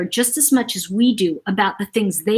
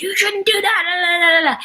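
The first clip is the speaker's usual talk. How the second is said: mock yell; 9 semitones higher than usual. Store owner scolding the boy who pocketed something, mock yell. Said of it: "he yells at him and says".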